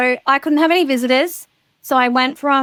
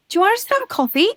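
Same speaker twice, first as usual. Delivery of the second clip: quick chirpy offer; 8 semitones higher, much faster than usual. Retold or imitated. Quoting herself inviting Erin.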